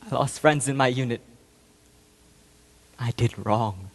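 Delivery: low, hushed admission, dropping further; subdued remorse. Acted. Soldier admitting wrong after the killings, quietly remorseful. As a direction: sink into quiet, low regret.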